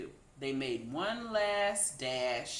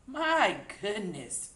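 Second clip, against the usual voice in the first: cried; 4 semitones higher, 5 dB louder, slower than usual. A narrator's reading. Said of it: "she cried".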